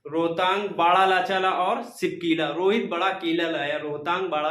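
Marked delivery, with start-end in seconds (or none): none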